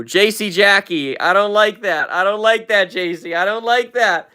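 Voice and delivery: usual speaking voice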